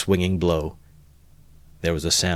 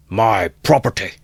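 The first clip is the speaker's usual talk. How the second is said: louder defiant cry; angry shout; 3 semitones higher, 7 dB louder than usual. A narrator's reading. Mikolka brandishing the bar, defiant shout. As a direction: bellow it with bloodshot eyes. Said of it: "shouted Mikolka".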